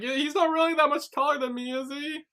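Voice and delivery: high-pitched, rushed tearful whine; mock crying. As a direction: imitate someone wailing through a complaint. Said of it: "'Cause he's crying".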